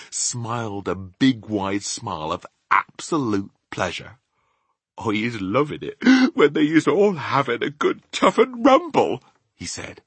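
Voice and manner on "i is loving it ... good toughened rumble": gleeful giant. A narrator's reading. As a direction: relish that climbs higher and louder. BFG delights in the giants' brawl.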